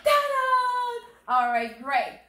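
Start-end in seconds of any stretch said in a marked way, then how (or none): 0.0-0.5 very high, loud reveal fanfare. Pretend. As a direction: belt out a triumphant ta-da.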